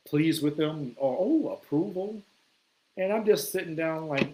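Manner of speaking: usual speaking voice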